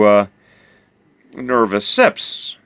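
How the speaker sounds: usual speaking voice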